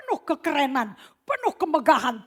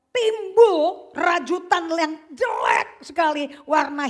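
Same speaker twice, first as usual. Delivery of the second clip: slow, loud, drawn-out shout; 4 semitones higher, 7 dB louder, slower than usual. Acted. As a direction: stretch each word out loud in disgust. Preacher shouts ugly cocoon.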